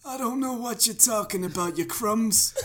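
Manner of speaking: lightly voiced New Jersey boss; a hint of New Jersey accent; Jersey mob boss. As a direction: shrug it off like a Jersey mobster. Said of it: "And he's like".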